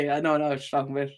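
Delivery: usual speaking voice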